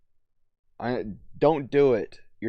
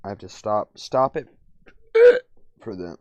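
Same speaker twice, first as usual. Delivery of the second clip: normal talking voice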